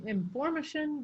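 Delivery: inFORmation, high tone on the second syllable; misplaced stress. Shown as a demonstration. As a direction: lean on "for" in information, "mation" falling away. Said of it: "the tone's not there".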